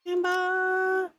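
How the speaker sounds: very high, squeaky Gamba. Pretend. Repeats word playfully.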